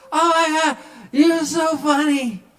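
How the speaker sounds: very high, loud, slowed gushing; drunk gushing. Retold or imitated. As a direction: swoon like a drunk fan.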